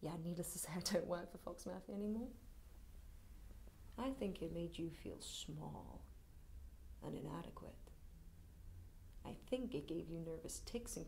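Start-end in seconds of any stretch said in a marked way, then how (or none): 4.0-11.1 quiet taunt. Acted. Telling Pilate he felt small, quiet taunt.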